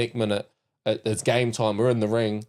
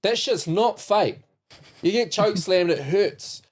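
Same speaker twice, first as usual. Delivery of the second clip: emphatic; 8 semitones higher, slower than usual. Real, not acted. Insisting wrestling really hurts.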